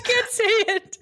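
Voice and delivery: high-pitched wail through real laughter; cracking up, voice shooting up high; helpless laughter. Real, not acted. Collapsing in laughter at own pronunciation.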